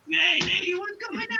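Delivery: high-pitched Italian American gangster; crappy Italian American accent. Acted. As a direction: ham up an Italian American wise guy. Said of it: "crappy Italian American accent".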